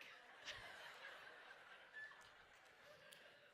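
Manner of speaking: breathy unvoiced laugh; a quiet laugh through sadness; soft laugh. Real, not acted. Joking at sister's deathbed, soft breathy laugh.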